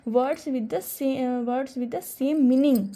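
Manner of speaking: slow, deliberate dictation. Shown as a demonstration. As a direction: read the definition out slowly for notes. Teacher dictating definition slowly. (धीरे-धीरे परिभाषा लिखवाना)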